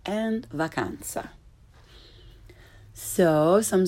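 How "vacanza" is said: "vacanza" with open ah, softer, closing the list; correct Italian pronunciation. Shown as a demonstration. Teacher ends the list, soft Italian "vacanza". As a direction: say "vacanza" as vah-KAHN-tsah. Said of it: "pronounced correctly".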